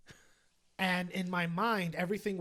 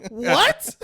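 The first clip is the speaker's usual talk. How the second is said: loud, slow, stunned what; shocked; 7 semitones higher, 16 dB louder than usual. Retold or imitated. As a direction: stretch the disbelief out loud. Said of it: "And I'm like".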